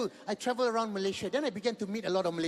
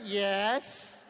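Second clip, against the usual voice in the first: ordinary talk, nothing marked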